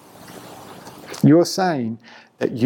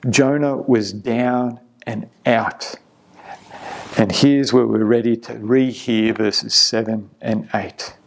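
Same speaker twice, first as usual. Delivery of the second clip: ordinary talk, nothing marked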